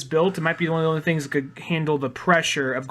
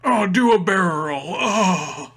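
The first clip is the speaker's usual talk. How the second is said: moaning; 3 semitones higher, 3 dB louder, much slower than usual. Pretend. Sexual joke about Slippy.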